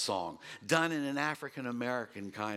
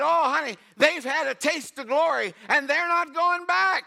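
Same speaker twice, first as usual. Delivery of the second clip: much higher and louder, exultant; joyful reply; 13 semitones higher, 9 dB louder than usual. Retold or imitated. Grandfather answering his granddaughter, higher, louder and exultant. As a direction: lift it high with joy. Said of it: "And I said".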